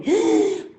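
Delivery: high storyteller's gasp; sharp, dramatic intake of breath; suspense gasp. Acted.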